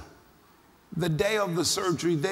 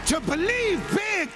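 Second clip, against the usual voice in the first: preaching at full force; 8 semitones higher than usual. Acted. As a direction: drive it home to the congregation. Preaching climax to the congregation.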